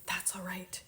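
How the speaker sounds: soft whispered comfort; whispered reassurance. Real, not acted. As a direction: whisper it kindly. Reassuring beginners.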